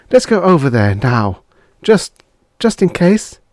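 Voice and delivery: wife begging husband to go to shelter; lightly voiced pleading wife. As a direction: urge him gently but firmly.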